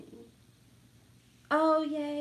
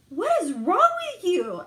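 incredulous outburst; 8 semitones higher, 10 dB louder than usual. Retold or imitated. Son reacting to dad's snoring.

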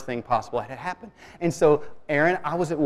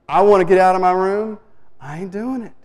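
mock stubborn little girl, higher pitch; defiant daughter; 4 semitones higher, 5 dB louder than usual. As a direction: imitate a child digging in her heels, loud then dropping. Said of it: "she looked at me like".

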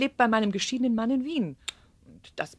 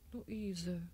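much softer, hushed repetition; stunned murmur; 2 semitones lower, 14 dB quieter than usual. Acted. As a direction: say the name again almost inaudibly. Mother repeats name, overwhelmed. (fassungslos gehaucht)

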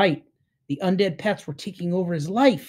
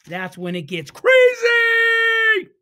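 teasing excitement; 6 dB louder, slower than usual. Real, not acted. Teasing the next chapter.

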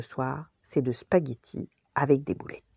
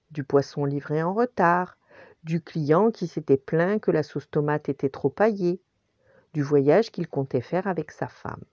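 usual speaking voice

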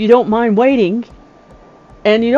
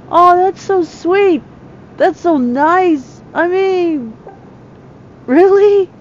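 much higher, slow and moved; touched delight; 8 semitones higher, slower than usual. Real, not acted. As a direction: gush over a surprise gift. Touched by fans' gift.

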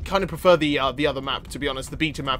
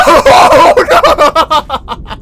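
loud, high scared laughter; shrieking laugh; 12 dB louder than usual. Real, not acted. Gamer shrieking with laughter as a catfish strikes.

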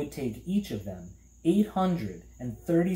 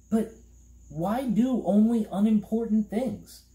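high, louder puzzled question; boy character voice; 11 semitones higher, 4 dB louder than usual. A narrator's reading. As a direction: protest in a high, bewildered voice. Milo questions the Trivium.

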